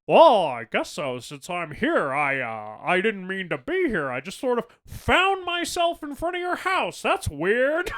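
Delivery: hammed-up fake innocence; mock sheepish George. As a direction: play it like a caught-out guy pretending it was an accident. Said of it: "And he's like".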